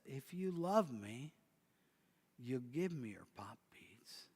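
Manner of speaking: a father pressing his request in a hush; father whispers his request to his daughter; soft whisper. Acted. Father repeats his request softly.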